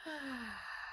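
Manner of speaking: long audible out-breath; slow breathing out. Shown as a demonstration. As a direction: sigh it out slowly. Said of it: "Breathe in with your butterfly wings".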